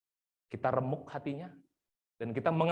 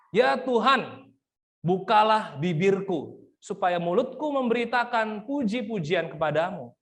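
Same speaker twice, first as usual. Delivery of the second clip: fervent psalm reading; 4 semitones higher, 6 dB louder than usual. Acted. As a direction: pray the psalm from full voice down to a hush. Reads the psalm aloud as prayer.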